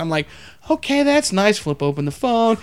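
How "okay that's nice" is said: humoring her. Retold or imitated. Humoring the rambling lady.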